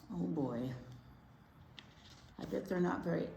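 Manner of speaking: ordinary talk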